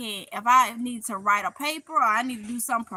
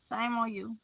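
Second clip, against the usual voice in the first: a quieter, trailing playful scold; softer mock shaming; 3 semitones lower, 8 dB quieter than usual. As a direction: Shame on you dropped much softer. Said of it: "I'm just playing".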